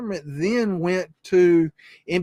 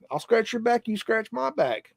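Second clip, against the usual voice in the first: usual speaking voice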